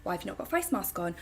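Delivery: lightly voiced indignant neighbour; mock accusing neighbour. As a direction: rattle it off like a nosy neighbour. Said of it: "Pauline pulled me up and was like".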